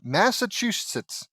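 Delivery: high, panicked stammering blurt; flustered, high-pitched fumbling; cracking under pressure. A narrator's reading. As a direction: blurt the wrong answer in a panic. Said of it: "Lincoln cracked under the pressure".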